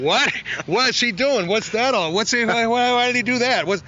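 high, stunned what-is-he-doing; baffled engineers. Retold or imitated. Studio crew startled by a lyric, high and baffled. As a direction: mimic the shocked crew behind the glass. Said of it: "looked up like this, like".

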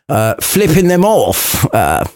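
ordinary talk

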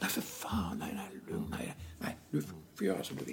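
whispered, rushed placating; hushed board member. Retold or imitated. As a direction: whisper to calm the angry volunteer. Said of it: "Och vad säger jag då?". (viskande lugnande styrelseledamot)

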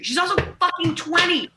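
high, loud disbelief; outraged at her being twenty; incredulous rant. Real, not acted. Ranting to friends about a young date, high and loud.

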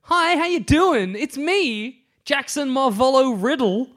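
very high, drawn-out voice; gushing high-school self. Acted. Younger Voldemort introducing himself, gushing high-school self. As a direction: play the over-eager teen introducing himself. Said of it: "you would talk like that in high school".

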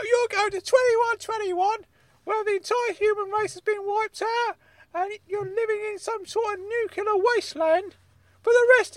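high, loud angry uncle character; Uncle Claude, angry. Acted. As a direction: rant at him in a high comic voice. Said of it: "Uncle Claude has got so angry with this question".

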